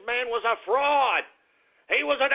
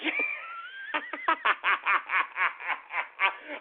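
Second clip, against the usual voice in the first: long delighted cackle rising in pitch; can't hold back a gloating laugh; gleeful laugh; 5 semitones higher, 4 dB quieter than usual. Real, not acted. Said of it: "I'm sorry I'm laughing".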